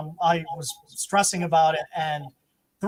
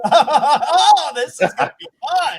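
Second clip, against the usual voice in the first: much higher, louder, laughing through it; maniacal laughing glee; 9 semitones higher, 8 dB louder, slower than usual. Retold or imitated. Wife laughing gleefully while raiding her drawers. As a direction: cackle with delight. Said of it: "maniacal female, like, Skeletor laugh".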